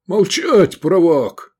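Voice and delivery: high, loud furious bellow; roaring. A narrator's reading. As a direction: roar to silence the navigator. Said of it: "заревел полковник".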